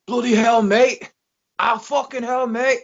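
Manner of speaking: high, hammed-up British cursing; mock pained British yell; mock British wrestler. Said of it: "you got the".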